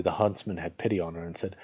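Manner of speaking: usual speaking voice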